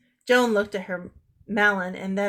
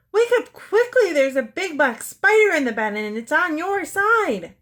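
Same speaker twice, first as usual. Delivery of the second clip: high, loud, urgent alarm; scary and urgent; 8 semitones higher, 6 dB louder than usual. A narrator's reading. Joan tricks her twin awake, scary urgent high yell. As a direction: frighten her awake with a fake emergency. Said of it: "making her voice scary and urgent".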